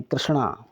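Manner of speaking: कृष्णा with retroflex n, falling away; corrected pronunciation. Shown as a demonstration. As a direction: curl the tongue for the n in कृष्णा. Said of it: "कृष्णा नहीं".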